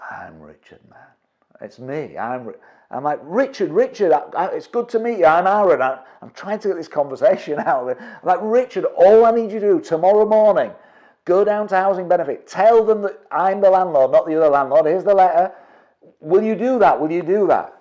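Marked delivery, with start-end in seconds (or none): 0.1-2.6 low, soft, slow stoned drawl; sleepy mumble, barely bothered; stoned tenant. Retold or imitated. Stoned tenant answering the landlord, low and slow. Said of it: "They are stoned". 3.2-17.7 high, loud, urgent pleading; frantic. Retold or imitated. Landlord begging the stoned tenant, high and frantic. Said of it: "I'm frantic about Adam outside".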